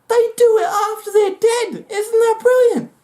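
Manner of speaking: high, half-crying with amazement; gleeful disbelief. Real, not acted. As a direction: squeal it like it's the best fact ever. Marvelling at the mantis.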